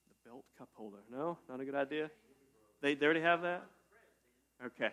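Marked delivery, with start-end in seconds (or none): 0.1-1.0 much softer, trailing repeat of "The belt cup holder"; deflated mutter. Pretend. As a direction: say it again to yourself as the idea fizzles. Pitching a silly product idea.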